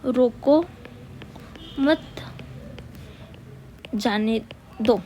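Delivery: each word alone, long gaps between; word-by-word dictation. Real, not acted. Teacher writing words for students, word-by-word dictation. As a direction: spell out the sentence slowly while writing. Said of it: "यहाँ पर लिखती हूँ मैं शब्द".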